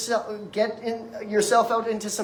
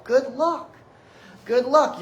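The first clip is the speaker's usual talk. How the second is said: higher-pitched, mocking send-off; sarcastic; 4 semitones higher, 3 dB louder, slower than usual. Real, not acted. Speaker to anyone expecting one pill to fix all, sarcastic. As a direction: wish them luck as if it is hopeless.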